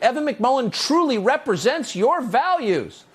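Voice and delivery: mocking quote. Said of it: "they write".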